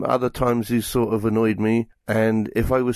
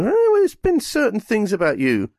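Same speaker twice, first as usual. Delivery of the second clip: much higher, wheedling little creature voice; a squeaky pleading creature; simpering Meep; 10 semitones higher than usual. Retold or imitated. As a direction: wheedle like a sly pet caught out. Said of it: "And the Meep".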